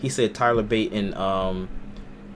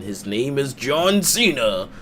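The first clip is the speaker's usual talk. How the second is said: John Cena announcer voice; 3 semitones higher, much slower than usual. Retold or imitated.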